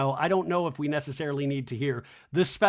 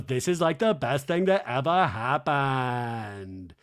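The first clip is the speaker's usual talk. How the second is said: slow, lower, drawn-out gush; Lisa; 4 semitones lower, slower than usual. Retold or imitated. Lisa delighted by the lookalikes, slow mimicked gushing. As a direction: stretch the praise out to the final word. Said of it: "And then Lisa goes".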